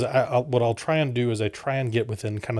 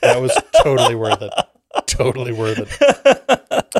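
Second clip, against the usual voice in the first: louder, laughing through the words; 8 dB louder than usual. Real, not acted. Cohost still giggling over the French bit, laughing. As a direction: crack up while saying it.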